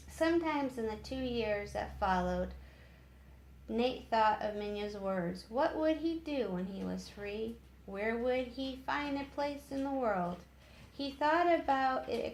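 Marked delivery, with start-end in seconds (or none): none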